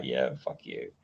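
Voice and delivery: imitating the jealous American boyfriend; grumpy boyfriend. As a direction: mutter it as the sulky boyfriend. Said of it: "her boyfriend just looked at me like".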